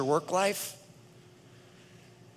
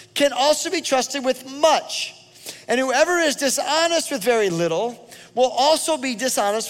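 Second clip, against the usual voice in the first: louder, higher, driving sermon voice; full-force preaching; 6 semitones higher, 5 dB louder than usual. Acted. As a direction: preach it hard to the congregation.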